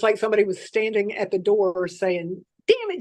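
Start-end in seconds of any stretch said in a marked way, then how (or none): 2.7-3.0 high-pitched mimicking exasperation; mock your own frustrated cursing up high; mimicked curse. Patient imitating unseen voice at the door, high mimicked frustration. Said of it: "just like I was saying it".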